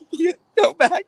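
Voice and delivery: laughing through the quote. Real, not acted. Laughing while retelling the threat.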